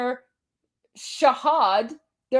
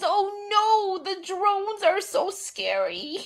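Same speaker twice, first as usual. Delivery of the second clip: mock scared; 6 semitones higher, 3 dB quieter, slower than usual. Mocking fear of drones.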